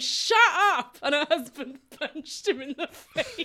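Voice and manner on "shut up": a sharp, louder mock "Shut up!" pitched up; retold snap at a chatty woman. Friend at the theatre tells a chatty woman to shut up, mock shout. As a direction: bark it at the woman talking behind him. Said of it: "I turned around and went".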